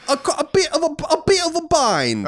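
Cockney, laid on thick like a gangster film; overdone Cockney accent. Pretend. Mocking British listeners, over-the-top Cockney accent. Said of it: "That was, that was too Cockney".

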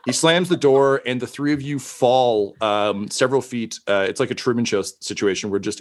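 usual speaking voice

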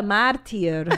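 "martyr" drawn out as mar-teer; mispronounced martyr. Shown as a demonstration. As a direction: say "martyr" slowly as mar-teer, ending like "tier". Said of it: "saying incorrectly".

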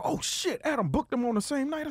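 high, breathy gasp of sudden realisation; retold alarm. Realising a scheduling clash, a high startled gasp. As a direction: catch yourself in a sharp, high alarm. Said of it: "and I go".

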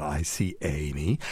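put-on slow, sing-song kids' show host, lightly done; a hint of a kids' TV host, unhurried; Romper Room host. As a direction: greet the viewers like a children's TV host. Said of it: "I sound like Romper Room again".

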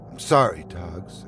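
a spent apology fading to almost nothing on "Togs"; sorry with a ruined, exhausted throat; wrecked voice. Acted. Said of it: "my voice a wreck".

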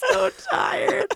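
high, laughing giggle through the words; laughing tiredly. Real, not acted. Admitting to a friend she's exhausted, laughing high. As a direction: laugh it out, punchy and overtired. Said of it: "I don't know why I'm getting emo".

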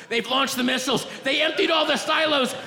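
high-pitched, frantic emergency announcement; hammed-up apocalyptic urgency; doomsday alarm. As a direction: sound the alarm for the end of the world. Acted doomsday emergency robocall.

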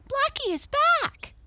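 very high, loud joyful announcement; girl crying out. A narrator's reading. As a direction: shout the news high, thrilled. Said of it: "cried Mabel".

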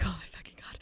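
barely voiced gasp of horror; retold horrified whisper; whispered dread. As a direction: whisper it under your breath, appalled. Retold dread as it got scarier.

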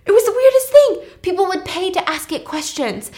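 high, loud gushing; fangirling. Retold or imitated. Robert raves about Jacques and his android, high-pitched fangirling. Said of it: "Robert is fangirling at this point".

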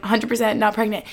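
ordinary talk, nothing marked

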